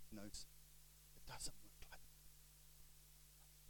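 hushed, low motherly brush-off; quiet dismissal. Retold or imitated. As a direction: wave it away almost in a whisper. Mum's retold dismissal of his preaching.

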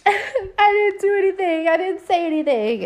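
high-pitched mock protest of innocence; Marianne. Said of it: "Marianne's like".

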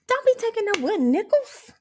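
ordinary talk

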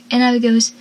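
usual speaking voice

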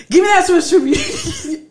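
high, loud mock order bursting into laughter; hammed-up grab-it-away demand, nearly cracking up; imitating fussing husband. Wife mimics husband seizing the sweeper, laughing mock scolding.

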